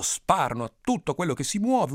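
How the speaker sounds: ordinary delivery, nothing marked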